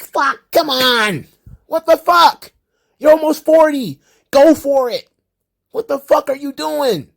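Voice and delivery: high, loud, incredulous yelling; exasperated rant. Acted. Podcaster yelling at Keith Lee, exasperated and high. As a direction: blow up in disbelief at a wasted chance.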